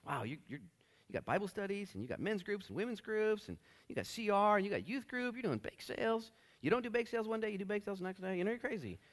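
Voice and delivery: high amused praise; impressed Jesus. Retold or imitated. Jesus addressing the busy church, lightly voiced. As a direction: marvel at their busyness.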